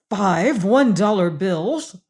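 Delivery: slow, astonished gasp of disbelief; gasping in surprise. A narrator's reading. Warden finding the money, gasping in shock. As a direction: breathe it out, stunned. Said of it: "he gasped".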